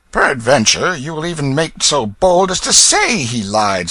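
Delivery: high, loud sneering challenge; scoffing. Acted. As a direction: dare him to say it. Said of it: "scoffed Hugo".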